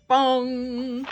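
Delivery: bell bongs. A narrator's reading. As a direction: boom each bong out like a big church bell. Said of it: "Last one".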